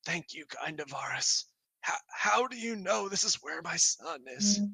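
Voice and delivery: lightly hammed-up tearful question, pitch lifting; acted weeping. Grieving Meepo Senior asks about his son, acted weeping. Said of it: "he's, like, weeping. And then he just says".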